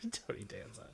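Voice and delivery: soft whispered "Tony Danza"; whispered joke. Acted. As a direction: drop to a hush for the punchline. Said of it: "Tony Dancer".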